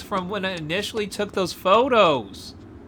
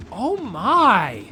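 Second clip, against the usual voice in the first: usual speaking voice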